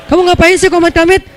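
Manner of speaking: nagging wife. Retold or imitated. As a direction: play the annoyed wife, lightly. Wife nags husband in story. (istri yang mengomel, ditirukan ringan)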